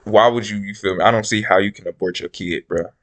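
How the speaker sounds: normal talking voice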